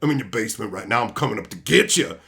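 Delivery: low, creepy stalker voice; low mock horror-movie creep. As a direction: menace them from the basement. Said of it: "I'd be like".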